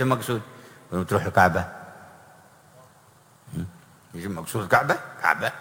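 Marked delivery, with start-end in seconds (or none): none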